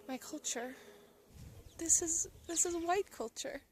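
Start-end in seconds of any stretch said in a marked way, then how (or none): none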